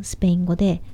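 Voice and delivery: ordinary delivery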